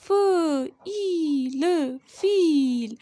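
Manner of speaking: ल held long, then फील drawn out slowly; slow phonics demo of फील; slow blending. Shown as a demonstration. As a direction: drag the sounds out slowly. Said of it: "phonics blending demo".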